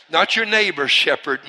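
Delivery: ordinary talk, nothing marked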